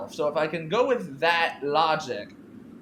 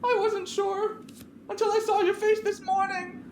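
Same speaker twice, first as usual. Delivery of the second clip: much higher pointed accusation; Clarissa accusing. Acted. Clarissa tells Veronica her face gave her away. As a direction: let her see you know.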